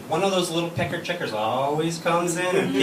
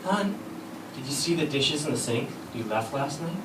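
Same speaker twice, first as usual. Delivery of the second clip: sweet wife; 6 dB quieter than usual. Retold or imitated. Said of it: "my wife says to me".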